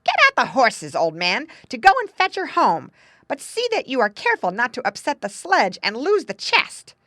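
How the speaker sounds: loud, high, bossy orders; impatient. A narrator's reading. Mother sending husband to fetch her daughter, impatient. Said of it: "as she grew impatient".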